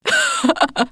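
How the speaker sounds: high mocking cackle; robbers' laughter. Robbers mock the old woman, high cackle. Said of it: "All the robbers laughed".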